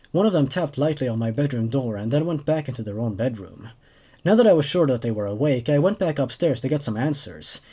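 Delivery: steady, even first-person storytelling; calm narrator drawing listeners into the story; story narration. A narrator's reading. Resumes reading the story.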